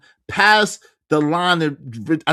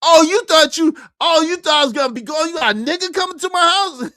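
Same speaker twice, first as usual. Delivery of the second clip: very high, loud accusing yell; husband screaming about a man at his door; furious yelling; 11 semitones higher, 5 dB louder than usual. Retold or imitated. Furious at late-night knock.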